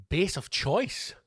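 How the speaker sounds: drawn-out mocking echo; incredulous. Real, not acted. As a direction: query the odd phrase in bafflement. Mocks wording of listener's question.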